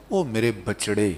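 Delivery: low tender Punjabi endearment; soft, affectionate fatherly call; very loving. Acted. Father uses a pet name for his child, tenderly. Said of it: "بڑے پیار سے کہا".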